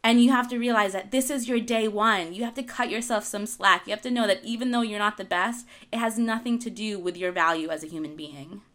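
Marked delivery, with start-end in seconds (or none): none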